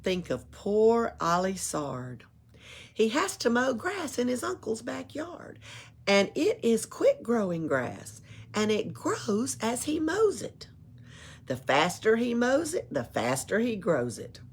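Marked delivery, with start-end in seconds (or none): none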